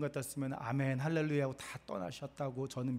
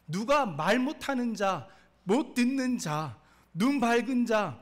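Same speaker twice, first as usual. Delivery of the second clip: lightly voiced God, loud and raised; God's challenging questions; 6 semitones higher, 7 dB louder than usual. Preacher voicing God's challenge to Moses, loud and emphatic. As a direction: press each question emphatically.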